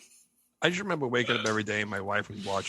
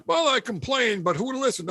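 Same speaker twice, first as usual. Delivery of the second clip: higher, louder mock complainer; that one guy; 11 semitones higher, 5 dB louder than usual. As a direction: imitate the customer with the stock joke answer. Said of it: "there's always that one guy".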